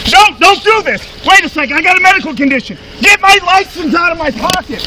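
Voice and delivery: urgent pleading. Real, not acted. Detained man with medical condition begging officers, urgent pleading. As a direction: beg them to stop, voice pushed up.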